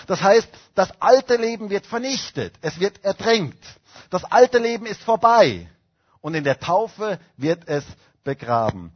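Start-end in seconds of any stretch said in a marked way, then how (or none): 4.1-5.7 proclaimed refrain. Acted.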